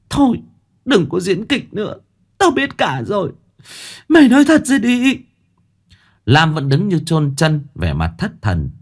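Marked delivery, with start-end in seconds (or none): 0.1-5.2 angry outburst. A narrator's reading. Chanh demanding the truth from Lam, angry. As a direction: cut her off, build to a cry. Chanh confronts husband's mistress, enraged.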